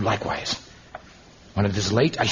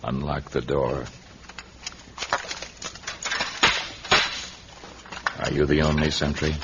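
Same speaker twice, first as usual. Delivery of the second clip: tief, leise, beschwörender Hypnotiseur; hypnotisierend; 8 semitones lower, 5 dB quieter than usual. Acted. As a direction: leg sie mit tiefer, leiser Stimme in Trance. Commanding the hypnotized guard.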